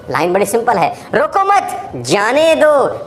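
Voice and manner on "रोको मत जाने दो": high "रोको मत", full stop, then slow falling "जाने दो"; pause after मत. Shown as a demonstration. Teacher placing a full stop, pause after मत. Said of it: "मत पे मैंने फुल स्टॉप लगाया".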